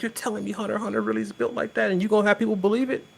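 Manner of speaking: fast, lightly voiced disbelief; incredulous. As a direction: scoff in disbelief. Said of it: "And I'm like".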